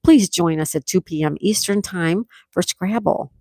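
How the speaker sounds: normal talking voice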